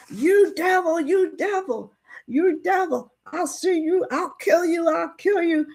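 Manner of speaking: high, furious repeated cry of devil; furious threat. Retold or imitated. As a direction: spit it at him like an enraged father. Furious father attacks the judge.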